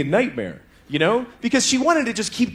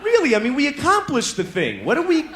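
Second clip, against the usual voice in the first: higher, pleading disbelief; exasperated protest; 7 semitones higher than usual. Retold or imitated. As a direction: whine like you can't believe it. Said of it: "And I was like".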